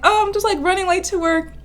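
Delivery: high-pitched retold answer; acted flustered reply. Telling her crush she's late, high flustered re-enactment.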